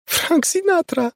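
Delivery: very high, slow squeak; high slow disbelieving squeak. A narrator's reading. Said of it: "pisnęła Molly".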